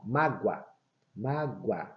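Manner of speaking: "mágoa" said with the O as a U glide; O sounding as U. Shown as a demonstration. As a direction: say "mágoa" as MA-gwa. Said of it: "Repare, quando eu pronuncio".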